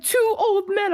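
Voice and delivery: high, insistent voice; mock insistent sister. Imitating her sister pressing the clue, high and insistent.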